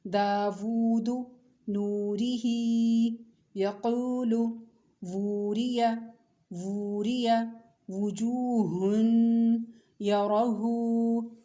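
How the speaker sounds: teacher reciting for pupils to copy, vowels drawn out; drawn-out recitation. Shown as a demonstration. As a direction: stretch each long vowel a full alif. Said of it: "मैं पढ़ के दिखाती हूँ".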